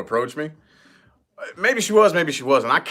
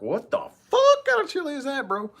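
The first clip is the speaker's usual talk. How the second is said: baffled contest judge; 13 semitones higher than usual. Retold or imitated. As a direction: imitate a judge stunned by the chili. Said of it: "They'd be like".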